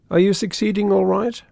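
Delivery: breezy, forced-casual question; careless air. A narrator's reading. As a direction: ask it lightly, hiding nerves. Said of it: "putting on as careless an air as possible".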